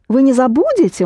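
"забудете" lifted high on the stressed syllable, then falling. Shown as a demonstration. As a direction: ask it by raising the voice on "забудете". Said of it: "only raise your voice".

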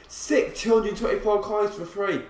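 ordinary delivery

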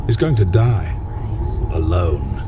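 villain's taunting. Acted. Villain taunts hero about trapped friend, gloating mock pity.

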